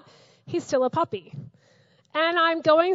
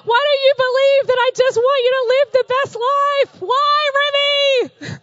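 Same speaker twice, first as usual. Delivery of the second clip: loud, high exasperated pleading at the puppy; frustrated dog owner; 10 semitones higher, 7 dB louder than usual. Retold or imitated. Owner pleading with her puppy, loud and exasperated. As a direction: beg him to see you only want his best. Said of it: "I get so frustrated and I'm like".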